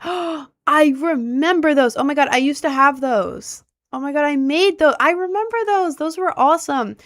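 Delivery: gasp, then high, loud excitement; thrilled rush of nostalgia; excited. Real, not acted. Gasps at a memory.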